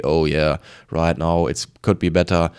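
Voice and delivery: normal talking voice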